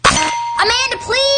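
mocking high call. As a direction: sing it out in mock exasperation. Quiz catchphrase called out.

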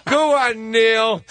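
high, forced fan laugh; overdone fake laughter. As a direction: laugh far too much at a hero's joke. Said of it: "overly laugh at their joke".

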